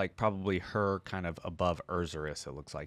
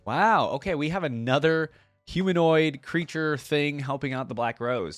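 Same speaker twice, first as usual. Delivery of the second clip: bright surprised burst, louder and higher; excited; 5 semitones higher, 6 dB louder than usual. Real, not acted. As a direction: react with genuine amazement. Surprised reaction to lore.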